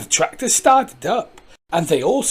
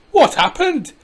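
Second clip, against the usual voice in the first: high, louder call; shouting; 10 semitones higher, 5 dB louder than usual. Acted. Sarah calling out after the crash, loud and high. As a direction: shout it across the garden. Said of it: "shouted Sarah".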